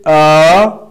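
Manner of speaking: "Uh" sliding from down to up; rising tone. Shown as a demonstration. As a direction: start "Uh" level, then lift it loudly to the end. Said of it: "rise up from down to up".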